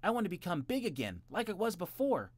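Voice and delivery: put-on high, loud child voice; tiny boy voice. A narrator's reading. Jimmy replies to his brother. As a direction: insist like a small boy. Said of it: "replied Jimmy".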